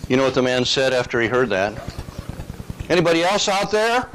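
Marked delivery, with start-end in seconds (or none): 2.9-4.1 high-pitched, hammed-up call for someone else; comic calling out. Man on the cliff seeking another rescuer, comic calling out. Punchline, stranded man calls out.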